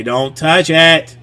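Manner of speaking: slow it down and lean in; firm slow warning. Retold or imitated. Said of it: "And I'll say".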